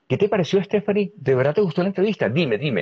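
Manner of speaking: high, eager, needy asking for praise; approval seeking. Acted. Begging others for approval, acted.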